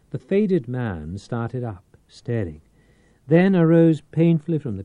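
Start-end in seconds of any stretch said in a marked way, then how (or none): none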